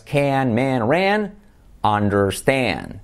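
CAN, MAN, RAN with a drawn-out "ea" vowel; drilled vowel. Shown as a demonstration. Teacher modelling a vowel pattern to repeat, drawn-out. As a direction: stretch the "ea" in UNDERSTAND, very slow. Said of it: "por lo tanto repetirías".

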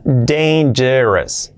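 "Dangerous" stretched syllable by syllable; very slow "Dangerous", DEIN-je-res; slow demonstration. Shown as a demonstration. Teacher breaks down a tricky word: slow "Dangerous". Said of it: "Esta palabra es complicada".

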